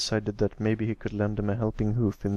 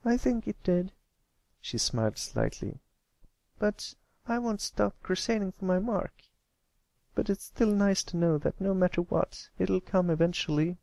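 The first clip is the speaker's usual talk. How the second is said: high, brighter relieved filly voice; smiling filly; 9 semitones higher than usual. Acted. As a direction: sound cheered up and determined.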